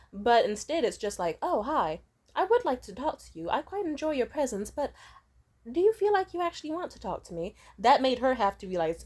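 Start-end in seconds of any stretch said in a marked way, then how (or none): 1.4-7.6 higher, sugary polite greeting; high sweet fake-polite question to the mom; overly sweet. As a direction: kill her with kindness. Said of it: "a nice layer of kindness to kill them with".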